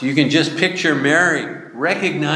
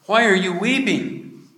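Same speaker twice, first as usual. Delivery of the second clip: gently questioning, pitched higher; voicing Jesus; 9 semitones higher than usual. Jesus gently asks weeping Mary why she cries, higher voice.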